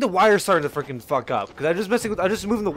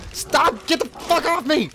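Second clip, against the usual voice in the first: frantic protest; 8 semitones higher, slower than usual. Real, not acted. Grabbed by enemies in the game.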